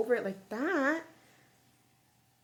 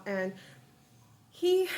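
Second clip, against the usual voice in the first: usual speaking voice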